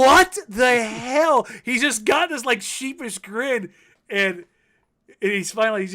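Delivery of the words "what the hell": loud, high, drawn-out disbelief; a dragged-out gasp of "what the hell"; stunned disbelief. Retold or imitated. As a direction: stretch it out, stunned, like he can't believe it. Voicing stunned teammate.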